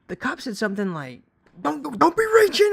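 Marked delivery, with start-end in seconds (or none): 1.6-2.7 high, loud mock yell of the cop; retold cop yell. Cop yelling at Lamone reaching for the seat, loud. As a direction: bark the warning like an angry cop. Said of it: "the cop yells at him".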